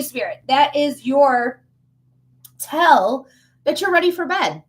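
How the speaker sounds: usual speaking voice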